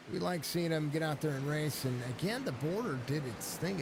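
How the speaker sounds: ordinary talk, nothing marked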